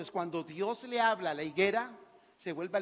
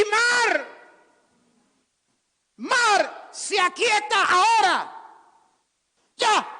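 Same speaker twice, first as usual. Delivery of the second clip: high, ringing shouted command; trumpet-like commanding roar; Jesús; 12 semitones higher, 11 dB louder, much slower than usual. Acted. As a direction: order the sea still at full voice. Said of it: "voz de trompeta".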